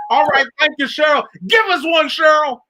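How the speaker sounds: playful impression of a radio host thanking a caller; Angelo imitation. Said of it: "Here's my, here's my Angelo imitation".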